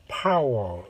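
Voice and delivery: exaggerated American "파워" from a widened mouth; "파워" in a thick, rounded American voice; American pronunciation. Shown as a demonstration. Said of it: "미국은".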